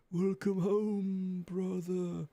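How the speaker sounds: slow, drawn-out ghostly echo. Acted. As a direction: stretch it out like a ghost. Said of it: "almost like a ghost effect".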